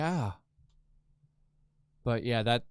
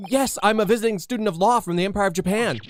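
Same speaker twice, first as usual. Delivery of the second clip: put-on high, loud polite young lawyer; 8 semitones higher, 6 dB louder, faster than usual. The lawyer explaining himself to the governor, high and polite. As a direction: play a polite eager young lawyer, pitched up. Voicing the Japanese lawyer character.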